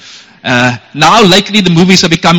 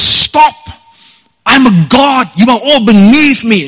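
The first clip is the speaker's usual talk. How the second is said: high, raised mock shout; Loki; 6 semitones higher, slower than usual. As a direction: cry out like an outraged god. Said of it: "Loki shouts and says".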